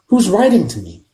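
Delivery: Harry confronts his uncle; demanding boy. A narrator's reading. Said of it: "said Harry".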